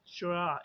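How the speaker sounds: चुरा slowly, one blended "chruh"; t and r fused into चुरा, drawn out; chr sound. Shown as a demonstration. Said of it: "इसको चुरा sound कहते हैं". (चुरा धीरे से, ट और र मिलाकर)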